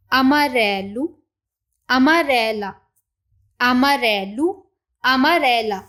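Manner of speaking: slow, careful Portuguese words for learners to repeat; each ending sounded out clearly, -o then -a; model pronunciation. Shown as a demonstration. Teacher models amarelo and amarela for the class, slow and clear. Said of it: "पहले हम इनकी pronunciation को देख लेते हैं".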